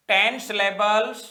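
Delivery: drawn out, word by word; slow dictation. Shown as a demonstration. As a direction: read it out for copying. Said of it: "dictating notes slowly". (धीमे स्वर में लिखवाना)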